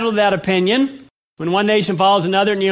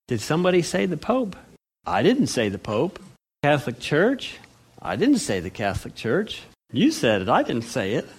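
low, softer aside with upward-flicking endings; coy mock innocence; 3 semitones lower, 5 dB quieter than usual. Preacher pretending he never named the Pope, coy mock innocence. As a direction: playfully deny it, lifting the last word. Feigned innocence, teasing the congregation.

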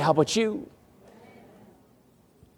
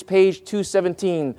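ordinary talk, nothing marked

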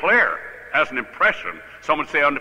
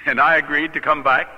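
usual speaking voice

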